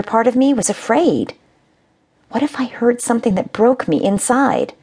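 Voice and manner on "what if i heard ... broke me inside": ordinary delivery, nothing marked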